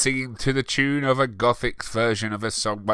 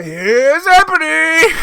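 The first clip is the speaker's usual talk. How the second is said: very high, loud, slow announcement; Here's Johnny parody; 8 dB louder, slower than usual. Retold or imitated. Ebony bursting in like Nicholson, high and slow. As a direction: draw it out like The Shining. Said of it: "boots in as a Nicholson".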